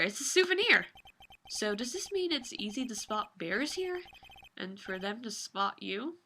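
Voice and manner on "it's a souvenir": surprised. Real, not acted. Surprised by the bear sculpture.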